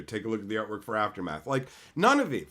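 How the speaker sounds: ordinary delivery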